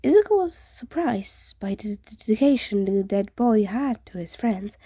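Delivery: usual speaking voice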